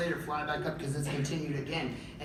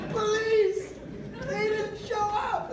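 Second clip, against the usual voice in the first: high, slow hammed-up wail; wailing to police; much slower than usual. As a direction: stretch the words like a mock sob.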